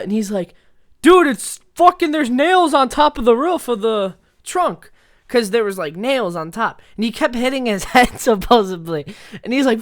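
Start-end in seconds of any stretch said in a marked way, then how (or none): 1.0-4.9 high, loud agitated complaint; loud protest. Retold or imitated. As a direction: shout the complaint from inside a trunk. Imitates friend complaining from trunk.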